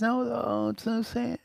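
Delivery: high, soft pretend lament; mock sadness. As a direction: whimper it in hammed-up sorrow. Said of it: "not with sadness".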